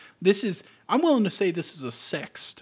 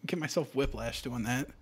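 ordinary delivery

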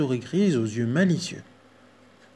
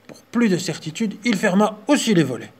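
plain reading voice

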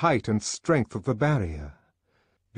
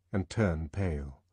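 ordinary delivery

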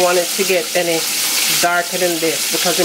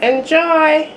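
excited sign-off; 9 semitones higher, 4 dB louder than usual. Real, not acted. Cheerful sign-off catchphrase.

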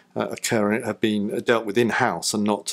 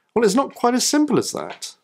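much higher pointed correction; pointed rebuttal; 13 semitones higher than usual. Acted. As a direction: puncture their assumption. Sharp rebuttal.

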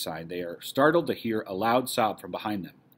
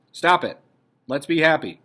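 loud, higher interjection; impatient outburst; 5 semitones higher, 8 dB louder than usual. Acted. Dussel to the others blaming themselves, impatient. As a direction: cut off the self-pity firmly. Dussel cuts short the self-blame.

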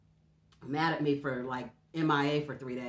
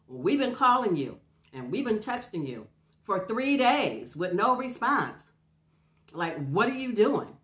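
higher, louder, slowing frustrated scolding; retold angry scolding; 7 semitones higher, 8 dB louder than usual. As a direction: imitate the upset daughters chewing her out. Worried daughters scolding, retold.

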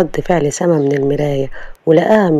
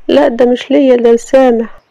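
put-on higher young woman asking a favour; Sama on the phone; 7 semitones higher, 3 dB louder than usual. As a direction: ask the driver politely, voice raised high.

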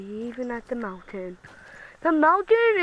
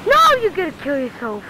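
louder, higher warning; alarmed warning; 6 semitones higher, 7 dB louder than usual. Real, not acted. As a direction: cry out to stop him. Warning teammate.

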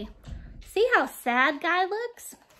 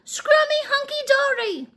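high, loud dog cheer; cheering shout; 11 semitones higher, 4 dB louder than usual. Acted. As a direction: cheer it out like a proud pup.

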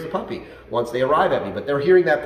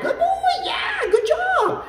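upbeat praise; slower than usual. Shown as a demonstration. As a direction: pump the dog up with high, bright praise. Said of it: "they need a little more like".

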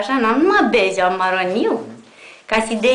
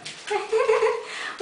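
quiet high giggle; soft high laugh; 9 semitones higher, 7 dB quieter than usual. Real, not acted. Owner giggling softly at puppies fighting.

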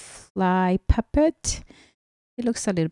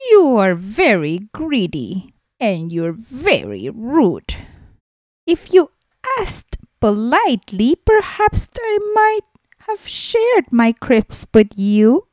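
mouse scolding; 4 semitones higher, 5 dB louder than usual. Acted. Mouse scolds seagull in story.

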